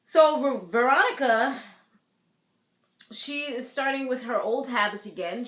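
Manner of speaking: ordinary delivery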